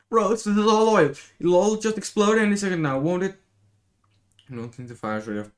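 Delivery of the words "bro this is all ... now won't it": panicked. Acted. Panicking about exploding oil.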